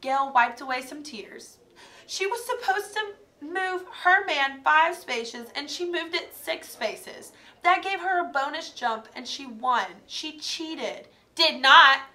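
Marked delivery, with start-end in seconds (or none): 2.1-11.1 high, tearful complaint; upset. Acted. Gail tearfully explains. 11.4-12.0 loud denial; shout. Acted. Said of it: "Heidi shouted".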